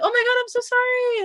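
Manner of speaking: very high-pitched retold apology; mock gushing apology. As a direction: squeal it like an embarrassed apology. Retells apology after bumping his mom.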